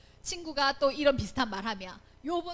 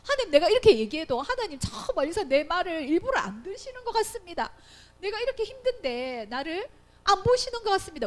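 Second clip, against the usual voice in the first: higher, louder plea to a distant God; anguished complaint; 5 semitones higher, 5 dB louder than usual. Acted. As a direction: act out Job protesting at being ignored. 욥의 원망을 연기함. (하나님께 원망하며 호소하는 목소리)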